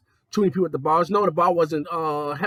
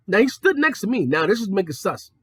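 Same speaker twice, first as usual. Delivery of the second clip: ordinary talk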